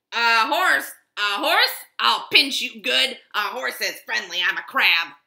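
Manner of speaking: loud, snappy crab voice; grumpy crab. Acted. Crab chasing Cowboy away, loud and cranky. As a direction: snap at him like a cranky crab. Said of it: "said the crab".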